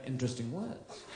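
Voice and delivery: quiet, unimpressed cat murmur; bored cat. Acted. Cat ignores its owner's commands, quiet and unimpressed. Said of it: "the cat will just be sitting there going".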